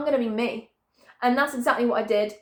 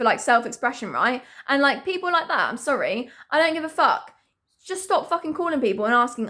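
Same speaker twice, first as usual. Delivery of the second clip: ordinary talk, nothing marked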